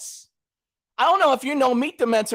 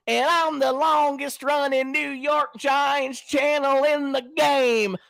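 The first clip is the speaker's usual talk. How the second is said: slow, higher-pitched mock boast; drawn-out, hammed-up bragging; imitating Kyle Pizzle; 5 semitones higher, slower than usual. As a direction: drawl it out like a self-crowned champion. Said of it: "He says it like this".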